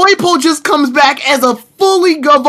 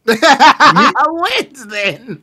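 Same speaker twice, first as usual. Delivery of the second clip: repeats it slowly through laughter; laughing; much slower than usual. Real, not acted. Friend laughingly repeats the question back. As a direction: echo the line while cracking up. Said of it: "laughing repeat".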